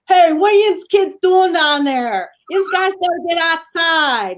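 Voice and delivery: comic Pittsburgh father calling out orders; Pittsburgh Dad. Retold or imitated. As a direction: holler at the kids in thick Pittsburghese. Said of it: "Pittsburgh Dad is his name".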